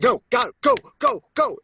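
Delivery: very high, loud repeated yells; urgent shouting. Retold or imitated. Instructor urging a jumper out of the plane, urgent shouting. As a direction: fire off each word as a shouted command.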